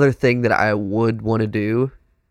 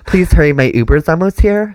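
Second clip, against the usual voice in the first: put-on Valley Girl voice; 5 semitones higher, 5 dB louder than usual. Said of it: "That Valley Girl shit".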